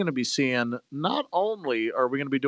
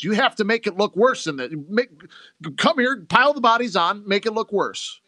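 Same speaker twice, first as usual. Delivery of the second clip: raised, louder urgent orders; scheming politician; 7 semitones higher, 4 dB louder than usual. Retold or imitated. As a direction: bark at them to stage the scene, up high. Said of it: "He goes".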